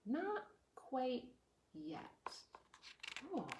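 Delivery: plain reading voice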